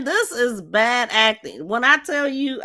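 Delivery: normal talking voice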